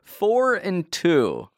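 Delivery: drawn out, high and disbelieving; slow, pointed disbelief; incredulous repeat. Real, not acted. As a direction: let each word of the bad stat sink in, slowly. Repeats the dismal rookie averages incredulously.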